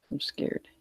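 half-joking frightened whisper; scared whisper. Acted. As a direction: breathe it out like a scared kid. Mock fear during losing match.

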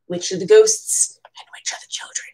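voiceless whisper of a playful question. Real, not acted. Asking the viewer about the picture, hushed whisper. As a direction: whisper it like a shared secret.

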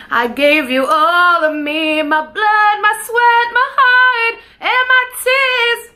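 scream-sing the climax; screaming the song. Real, not acted. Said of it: "I'm, like, screaming".

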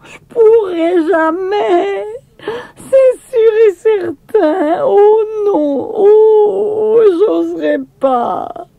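high, slow wailing sob; girl sobbing from the rooftop; desperate crying. A narrator's reading. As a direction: cry out in terror, too scared to jump. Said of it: "Ses cris se firent de plus en plus désespérés".

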